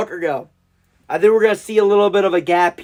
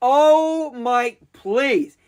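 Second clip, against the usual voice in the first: anguished plea; 6 semitones higher, much slower than usual. Real, not acted. Dreading rival pick.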